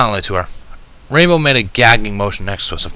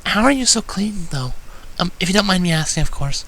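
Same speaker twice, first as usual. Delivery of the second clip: shy pony voice; 11 semitones higher than usual. Acted. Voices Fluttershy in the story.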